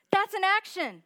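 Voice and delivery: emphatic preacher repeat; shouted emphasis. Acted. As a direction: hammer the point home. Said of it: "repeated for emphasis".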